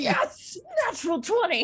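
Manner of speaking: thrilled shout over a lucky roll; excited cheer. Real, not acted. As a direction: whoop it out with real joy. Cheering a natural twenty roll.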